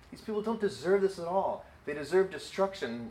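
high, indignant mock-angry outburst; mad. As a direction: act out a furious grudge. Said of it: "but it's somebody being mad".

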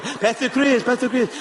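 hammed-up Chinese accent, calling out; imitated Chinese accent. Missionary calls the departing pastor back, imitated Chinese accent. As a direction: do your best Chinese-accented call. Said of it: "that's my best Chinese accent I got".

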